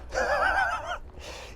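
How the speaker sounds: a real high burst of laughter; cracking up. Real, not acted. Laughs at own story.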